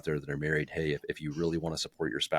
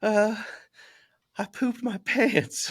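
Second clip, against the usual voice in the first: put-on high, slow kid voice with a laugh breaking through; little son's voice, cracking up; 3 dB louder, slower than usual. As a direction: play the sheepish boy while laughing. Dad voicing son, laughing.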